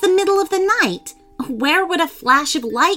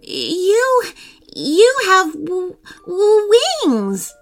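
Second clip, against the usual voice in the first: high, stammering disbelief; gasping shock; 11 semitones higher, 4 dB louder, slower than usual. A narrator's reading. Brother sees his sister's wings, gasping shock. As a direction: stutter up to an astonished cry. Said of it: "Riley gasped again".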